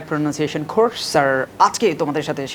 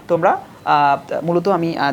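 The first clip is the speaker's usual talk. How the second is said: ordinary talk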